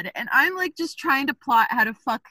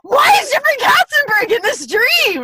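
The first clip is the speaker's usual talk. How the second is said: higher, louder, half crying, half laughing; crying shout; 12 semitones higher, 7 dB louder than usual. Retold or imitated. Retelling the dream, wails in baffled disbelief. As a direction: wail it in disbelief. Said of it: "I was just like".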